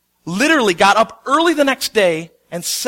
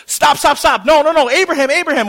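higher, louder repeated cries; urgent angel shout; 7 semitones higher, 6 dB louder than usual. Acted. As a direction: rush in to stop the knife. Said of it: "there's a level of urgency there".

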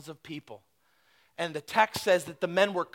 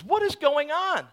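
high, loud amazed cry; amazed shout; 9 semitones higher, 4 dB louder than usual. Retold or imitated. Amazed crowd asking what is happening, high and loud. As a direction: crowd startled and demanding answers.

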